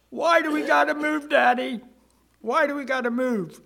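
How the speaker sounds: put-on high, loud, pleading child voice; a crying little girl begging her daddy. Daughter in the car begging her daddy, high and pleading.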